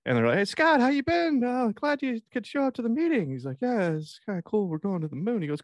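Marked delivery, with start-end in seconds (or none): 0.4-3.3 high, over-friendly greeting to an unwanted colleague; hammed-up chirpy office hello, pitched way up; coworkers greeting. Coworkers fake-greet the disliked guy. 3.6-5.4 high, small dorky voice; high-voiced hapless coworker; awkward office loner. Plays awkward Scott in meeting.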